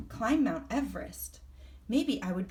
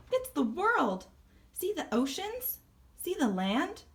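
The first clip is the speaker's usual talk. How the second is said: higher, eager voice; excited pointing out; 9 semitones higher than usual. Acted. Sassy showing the iguana the globe, excited. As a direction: show it off with wonder.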